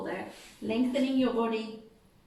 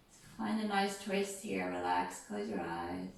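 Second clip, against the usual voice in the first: right in your ear; 2 semitones lower, 4 dB quieter than usual. Acted. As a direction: ease them down gently, close and soft. Yoga cool-down, eyes closed.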